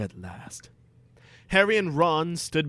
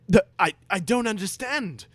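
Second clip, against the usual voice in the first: lightly voiced confused Ron; 8 semitones higher, 5 dB louder than usual. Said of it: "Ron said".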